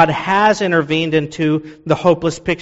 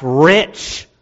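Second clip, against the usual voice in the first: "rich" set apart and pushed, like in all caps; stress on rich. Shown as a demonstration. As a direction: hit "rich" hard, as its own word. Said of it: "you could put in all caps".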